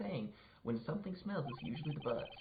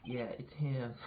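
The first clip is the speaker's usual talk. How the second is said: ordinary delivery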